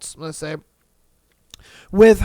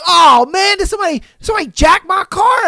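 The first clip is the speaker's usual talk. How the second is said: fast, loud, high mock alarm; hammed-up car theft panic; frantic shout; 9 semitones higher, 5 dB louder, faster than usual. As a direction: panic that the car got stolen. Acting out panic over car.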